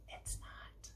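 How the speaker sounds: hushed, unvoiced whisper; very soft quick aside under the reading. Real, not acted. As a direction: whisper it privately to the listener. Quiet aside to the listener.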